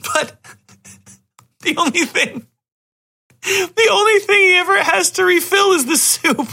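high-pitched, laugh-choked words; cracking up while trying to get it out; laughing hard. Real, not acted. Telling friends about the silly movie, squeaking with laughter.

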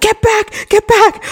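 high, urgent yelling; frantic shout. Retold or imitated. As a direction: push someone away in a panic. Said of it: "He's like".

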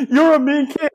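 high, strained imitation of a tormented man; mock whining victim. Stuck man to children torturing him, mock whining. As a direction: whine it high, hammed up.

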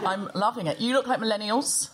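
ordinary talk

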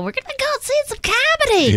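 high mock bland punter; generic audience. Comic mocking club crowd, high bland voice. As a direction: play a cheerful clueless crowd. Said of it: "you know".

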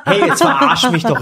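high, loud laughing protest; laughing disbelief. Real, not acted. Disbelief at caller being teacher.

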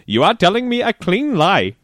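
high-pitched Indian accent impression; full-on Indian accent. Relative retelling the scammer's message to the sister, mock Indian accent. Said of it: "in his full-on Indian accent".

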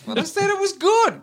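very high, protesting innocence; hammed-up defensive protest; mock defensive. Acting a defence to offended friend.

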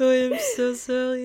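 mock French-accented apology, high and loud; hammed-up imitation of a French accent; thick French accent. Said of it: "he has a really thick French accent".

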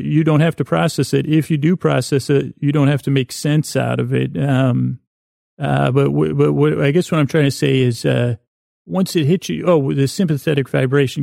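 normal talking voice

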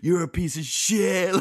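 high, slow, hammed-up yell; mock shouted insult. Retelling the art guy's parting insult as a mock yell. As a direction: bellow it like the offended guy.

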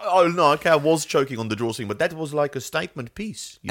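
high, breezy Alsace French accent, pretending to know fashion; a French driver at Fashion Week in a put-on Alsace accent. Esteban faking expertise at Fashion Week, Alsace French accent. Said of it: "given him an Alsace French accent".

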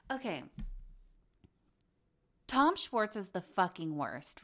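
usual speaking voice

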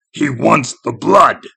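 loud, slow, menacing creature voice; sinister impostor girl. A narrator's reading. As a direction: draw out the demand ominously. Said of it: "said not Amy".

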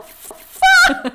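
very high, loud laughing cry; high, loud laughing swear; shocked yelp. Retold or imitated. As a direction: yelp it out in startled delight. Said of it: "Jag bara".

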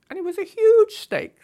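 shoots way up high and loud in amazement; huge snake, exclaimed. Real, not acted. Dramatic emphasis on snake size.

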